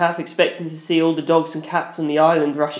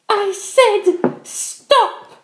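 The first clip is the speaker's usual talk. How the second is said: hissing; much slower than usual. Acted. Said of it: "Penelope hissed".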